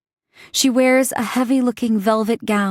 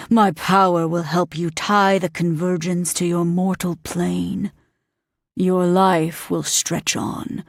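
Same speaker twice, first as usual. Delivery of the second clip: demon voice; 4 semitones lower than usual. Acted. Demon bargaining with Green, low and menacing. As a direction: speak as an ancient demon granting a bargain.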